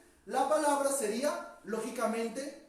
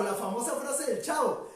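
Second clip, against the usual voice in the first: ordinary delivery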